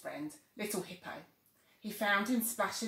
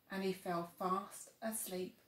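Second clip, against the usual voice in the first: ordinary delivery, nothing marked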